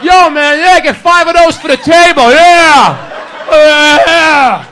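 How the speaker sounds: high, loud whooping American diners; mock excited shouting. Dazzled diners ordering from the French waiter, hammed-up excited shouts. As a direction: holler the order, building to a drawn-out yell.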